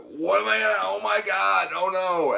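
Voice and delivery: a yelped oh my God in retold alarm; retold panic. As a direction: replay the frightened cry as it happened. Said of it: "I panicked".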